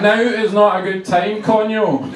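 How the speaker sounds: hammed-up irritation; mock angry retort. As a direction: shout back at someone at the door. Shouts back at landlord mid-argument.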